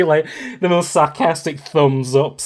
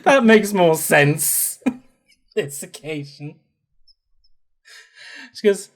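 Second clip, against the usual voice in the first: ordinary talk, nothing marked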